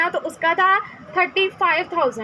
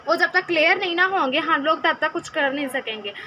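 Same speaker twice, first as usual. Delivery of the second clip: ordinary delivery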